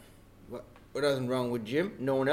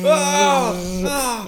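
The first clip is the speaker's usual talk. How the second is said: panicked cry; 10 semitones higher, 11 dB louder than usual. Acted. Panicked cries at hornets.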